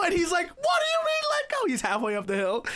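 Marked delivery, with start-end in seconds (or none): 0.5-1.7 very high-pitched incredulous shriek; mock-panicked squawk of disbelief; imitated yelling. Skier yells back at the kids from the rope, incredulous yelling. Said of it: "He's just yelling".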